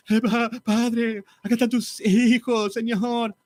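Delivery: high, slow whiny pleading prayer; mock a weepy orator dragging out each word; tearful preacher parody. Preacher parodying a weepy church orator, high whiny pleading. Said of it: "un orador medio llorón".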